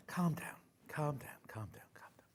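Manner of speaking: unvoiced, softening whisper; whispering to herself. Real, not acted. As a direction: whisper yourself calm. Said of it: "Getting overexcited".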